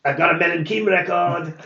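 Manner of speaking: lightly voiced tinny intercom voice; a hint of a thin, reedy guy over comms. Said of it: "thin, reedy voice".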